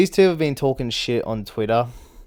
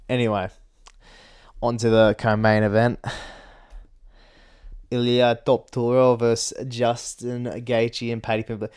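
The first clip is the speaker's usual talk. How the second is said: ordinary talk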